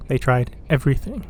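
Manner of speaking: ordinary delivery, nothing marked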